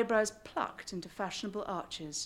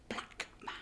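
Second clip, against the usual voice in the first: hushed scandalous whisper; whispered aside; 10 dB quieter than usual. Acted. Reader whispering the scandal to the audience. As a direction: drop to a secret whisper.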